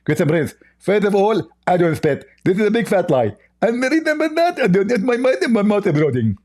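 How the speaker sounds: high, spluttering lisp; Zakir Naik. Acted. Mock preacher denying to the host that he spits, high and lisping. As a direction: ham up an indignant preacher with a lisp. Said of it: "It's like you are a spitting machine".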